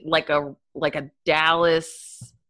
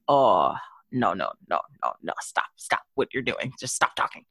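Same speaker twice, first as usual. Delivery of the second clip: low, hushed pleading to stop; pained plea, dropping low and fading; mock dismay; 9 semitones lower, 3 dB quieter than usual. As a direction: sink into a low, quiet groan of protest. Acts out dismay at harsh accents.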